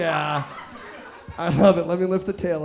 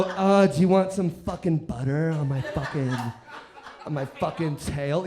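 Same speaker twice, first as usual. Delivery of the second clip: low-pitched, hammed-up naughty crab; mock-seductive crab, pitched low; 6 semitones lower than usual. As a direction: play a naughty crab making a lewd offer. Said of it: "Naughty crab".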